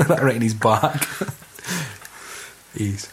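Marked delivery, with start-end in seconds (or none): none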